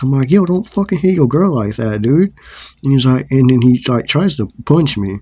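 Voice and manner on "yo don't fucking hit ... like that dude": retold high-pitched angry warning; re-enacted heated protest; pissed off. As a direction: scold them in acted indignation. I get pissed.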